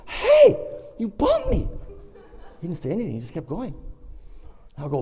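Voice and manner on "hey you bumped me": high, loud "hey" protest; indignant shout. Retold or imitated. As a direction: call out in high-pitched outrage. Indignant reaction to being bumped.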